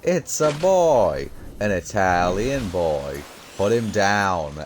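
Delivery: high, slow hammed-up Italian accent on "It's a boy"; drawn-out Italian accent with "boy" stretched; mock Italian accent. Quoting the TikTok narrator to a friend in mock Italian accent. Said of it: "talking about it in an Italian accent".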